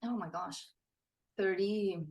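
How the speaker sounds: ordinary delivery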